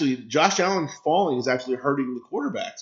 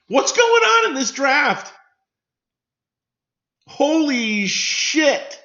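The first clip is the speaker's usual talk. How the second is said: much higher, loud shout of outrage, the last words drawn out slowly; real disbelieving shout; 12 semitones higher, 5 dB louder, much slower than usual. Real, not acted. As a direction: yell at the screen in real shock. Outburst at a shock draft pick.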